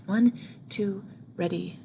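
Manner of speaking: ordinary talk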